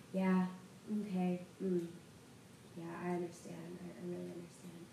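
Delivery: quiet phone murmur. Retold or imitated. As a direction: mumble gravely into the phone.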